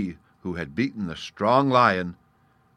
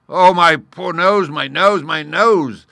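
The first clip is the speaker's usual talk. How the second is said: high, drawn-out wail of pain; loud moaning; 10 semitones higher, 13 dB louder, slower than usual. Acted. Lion stung inside his nose, moaning loudly. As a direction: wail over your injured nose. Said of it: "the lion began to moan".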